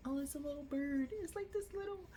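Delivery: soft, very high and fast; high cute voice. Retold or imitated. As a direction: squeak it out like spotting a tiny bird. Acting out a tender moment.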